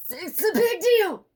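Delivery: high, loud, slow hammed-up indignation; mock outrage. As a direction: blow it way up. Mocking the complainer.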